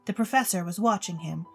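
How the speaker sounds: ordinary delivery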